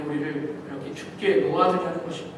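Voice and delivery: normal talking voice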